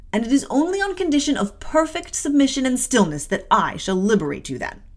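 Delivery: imperious, emphatic scolding; acted severe guardian; stern aunt. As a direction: lay down the punishment with cold authority. Aunt scolds and sentences child.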